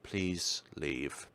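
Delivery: ordinary talk, nothing marked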